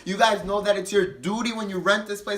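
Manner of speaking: hammed-up scolding customer; angry renters. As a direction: imitate the scolding drunk renters. Retelling angry drunk renters.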